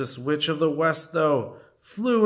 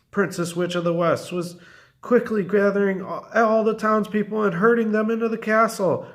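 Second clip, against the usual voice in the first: ordinary delivery, nothing marked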